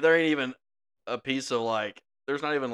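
usual speaking voice

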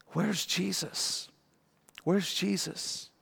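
soft, slow, longing question; quiet, slow yearning for Jesus; searching hush. Acted. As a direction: ask it twice, hushed and aching. Mary searching only for Jesus.

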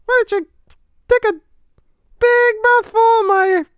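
high squeaky cartoon mouse; Mickey Mouse voice. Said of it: "Is Mickey a new impression?".

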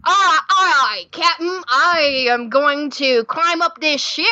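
eager Cockney pirate reporting for duty; Cockney pirate deckhand. Pretend. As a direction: call up to the captain in a mock Cockney sailor voice. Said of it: "my Dick Jones voice".

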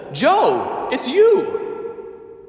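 very high, delighted call to a friend; acted burst of surprise; excited recognition. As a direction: call up, amazed to see your friend. Trapped man recognises his friend above.

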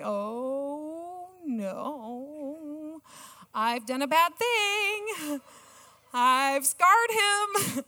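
rising high and slow, a gasp of dismay; slowing down as the horror sinks in; hushed then climbing high with guilt; retold panic. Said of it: "And I'm like".

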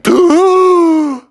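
loud, high surfacing gasp; gasp for air. A narrator's reading. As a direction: suck in air after near drowning.

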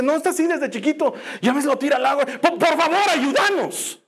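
high, strained plea; father stammering a plea for help; pleading father. Acted. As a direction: stammer out a frantic request for help.

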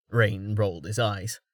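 plain reading voice

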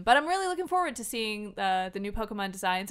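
usual speaking voice

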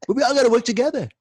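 high, fast mock politician; mock speech voice. Friend mocking a politician, high and fast. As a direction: ham up the rally cry.

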